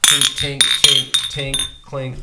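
loud mouth-made clinking sounds; teacup clink. Pretend. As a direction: say tink like glasses touching. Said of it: "mimicking glasses clinking, cheers".

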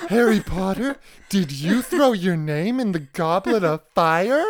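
a lightly voiced Dumbledore, loud; loud, hammy old wizard voice; old man voice.

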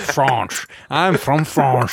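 slow, hammed-up Belgian accent; mock Belgian accent. Asked for a Belgian accent, jokingly attempts it in a Belgian accent. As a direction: ham up a Belgian accent, drawn out. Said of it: "who's got a good Belgian accent".

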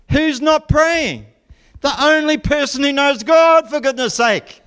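high, incredulous mock outrage; hammed-up indignation; exasperated disbelief. Exasperated at sleeping Jonah.

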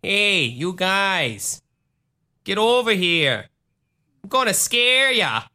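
high, drawn-out Wisconsin monster voice; Wisconsin accent. Acted. Monster luring people to scare them, Wisconsin accent. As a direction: put on a slow, high-pitched Wisconsin accent. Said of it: "sound like in a Wisconsin accent".

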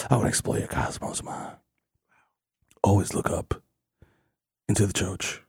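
mock mystic hush; hushed whisper. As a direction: whisper it like a cosmic secret. Spacey mock-mystic lines.

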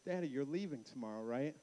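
soft, low acted-out child's worry; quietly seek confirmation from a parent; subdued daughter question. Daughter asks dad about leaving, imitated quiet question.

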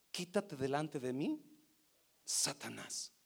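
low, hushed acted command; understated rebuke, dropping very soft at the end; quiet rebuke. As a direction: dismiss him softly, pausing before Satanás. Retells Jesus's rebuke quietly.

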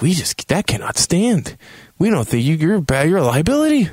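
high-pitched, hammed-up condemnation; mock outrage. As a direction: pronounce judgement in a lifted, scandalized voice. Acts council's outraged verdict.

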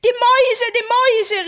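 high, loud cry of fright; frightened cry. Acted. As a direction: scream it in terror. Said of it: "rief Marie erschreckt".

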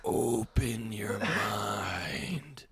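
slow whispered mutant oracle voice; Kuato. Pretend. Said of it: "I sounded like, uh, uh, Kuato".

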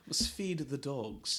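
posh RP echo. Retold or imitated. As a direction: repeat it slowly in a hushed received pronunciation. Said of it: "That's received pronunciation".

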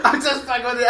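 laughing while repeating. Retold or imitated. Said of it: "She's like".

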